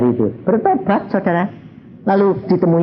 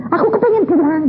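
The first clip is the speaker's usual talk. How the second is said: pleading to go home. Retold or imitated. Begging God to go home.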